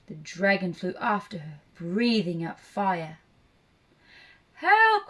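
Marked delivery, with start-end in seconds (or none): 4.5-5.1 high, loud cry; storybook damsel-in-danger yell; witch crying for help. A narrator's reading. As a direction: call out in alarm. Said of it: "cried the witch".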